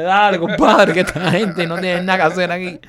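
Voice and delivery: high, loud voice holding back a laugh; amused officer. Retold or imitated. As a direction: urge him on, fighting the giggles. (agente aguantando la risa, agudo y fuerte)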